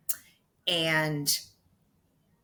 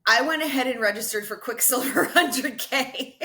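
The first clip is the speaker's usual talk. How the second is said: laughing through the words, a bit higher and louder; laughing confession; 6 semitones higher, 6 dB louder than usual. Real, not acted. Admitting her impulsive race signup to the host, laughing. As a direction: giggle it out like a guilty admission.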